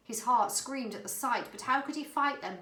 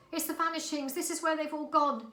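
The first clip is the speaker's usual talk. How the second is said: shuddering; 8 semitones higher, 4 dB louder than usual. A narrator's reading. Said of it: "she shuddered".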